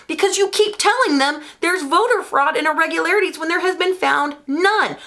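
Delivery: real worked-up, high-pitched rant; heated. Real, not acted. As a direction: argue heatedly, voice climbing high. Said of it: "I'm sorry, I'm heated".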